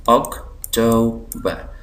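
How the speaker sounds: October slowly as OC, to, ber; syllable by syllable. Shown as a demonstration. Teacher showing three syllables, October slowly split. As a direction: leave gaps inside October. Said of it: "तीन syllable".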